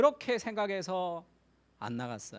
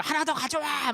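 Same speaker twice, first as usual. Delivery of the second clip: calling out; 8 semitones higher than usual. Retold or imitated. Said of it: "막 이런다고".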